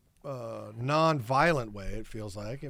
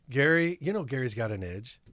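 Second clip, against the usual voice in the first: usual speaking voice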